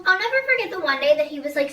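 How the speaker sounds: normal talking voice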